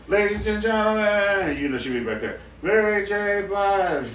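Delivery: high, loud mock stage announcer. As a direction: announce her entrance like an MC. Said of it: "She come from the back there like".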